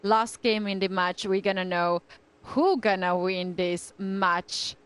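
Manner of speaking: ordinary talk, nothing marked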